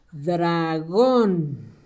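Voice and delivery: "Dragón" drawn out slowly and low; low, lingering "Dragón", syllable by syllable; sounding out. Shown as a demonstration. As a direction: stretch both syllables of "Dragón" for young writers. Sounds out word for the pupils.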